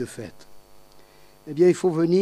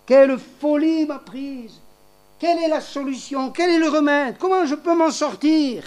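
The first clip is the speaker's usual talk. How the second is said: desperate son; 8 semitones higher than usual. Retold or imitated. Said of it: "Alors il a dit".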